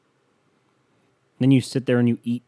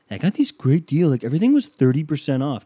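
higher, louder bragging shopper voice; smug bargain hunter; 5 semitones higher, 5 dB louder than usual. Retold or imitated. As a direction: brag about the deals, mockingly.

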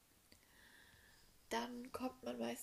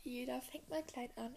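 usual speaking voice

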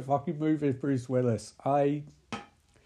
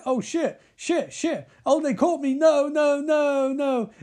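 high, panicked squeal, getting louder; hammed-up higher scared pleading; mock panic; 10 semitones higher, 4 dB louder, slower than usual. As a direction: play a terrified kid being caught. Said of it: "Spy Kid's sort of like".

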